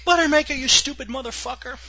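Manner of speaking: quoted movie yell. Retold or imitated. Quoting Bad News Bears to a cohost in a high mock yell. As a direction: shout it like the kid in the movie.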